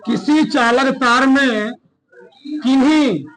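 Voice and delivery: measured classroom dictation, phrase by phrase; slow dictation. Real, not acted. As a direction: dictate slowly with long pauses for writing. (धीरे-धीरे रुक-रुककर इमला बोलते हुए)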